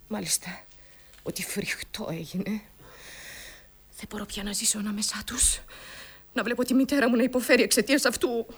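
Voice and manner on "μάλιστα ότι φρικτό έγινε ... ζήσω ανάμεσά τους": low, hushed whisper. Acted. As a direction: breathe the confession out. Confessing the horrible event. (ψιθυριστά)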